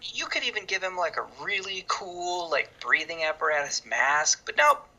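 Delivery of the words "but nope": exasperated outburst. Real, not acted. Sudden outburst.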